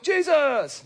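high mock answer Jesus; mock Sunday-school answer. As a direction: toss it off as the obvious answer. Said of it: "I'm surprised you didn't go like".

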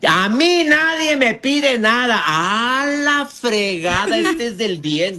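slow, drawn-out, high-pitched defiant yell; hammed-up stubborn yeller, words stretched out; shouting. The ten percent who keep yelling, shouting. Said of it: "sigue gritando".

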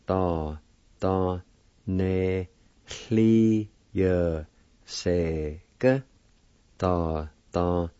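Da, da, ne, hli, ye, se, ge said apart; syllable by syllable. Shown as a demonstration. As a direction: spell out the sounds slowly. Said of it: "It's pronounced like this".

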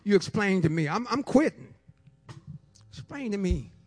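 ordinary talk, nothing marked

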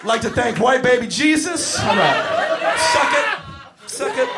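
loud, high gloating shout; wrestling villain gloating at the crowd, shouting; heel wrestler shout. Pretend. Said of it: "pretend to be a villain and a bad guy wrestler".

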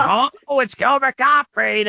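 host imitating the voice he expected on the phone; expected celebrity screech. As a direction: put on the famous comic screech.

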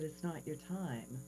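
soft, hushed, gentle verdict; gentle Jesus. Retold or imitated. Jesus turning her back at the bridge, soft and gentle. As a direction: break the news kindly.

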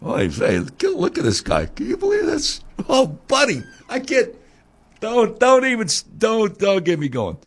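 hammed-up New Yorker who can't believe this guy; imitating New York lingo, exasperated. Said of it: "It's more like".

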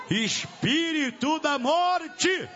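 high, drawn-out summons to a demon; high commanding cry. Real, not acted. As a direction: cry out to the spirit, stretching it slowly. (brado agudo e arrastado)

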